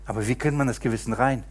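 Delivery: ordinary talk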